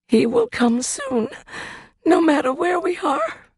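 put-on a scared young mother holding onto faith; Mary, frightened. Mary voiced in story.

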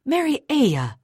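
high, loud reproachful call; scolding. A narrator's reading. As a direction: scold the nanny sharply by name. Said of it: "Missus Scudder scolded".

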